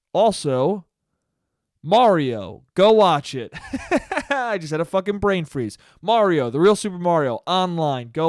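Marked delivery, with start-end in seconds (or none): 3.6-4.2 high quick giggle at own slip; embarrassed laugh. Real, not acted. Podcaster fumbling his plug, laughing at himself.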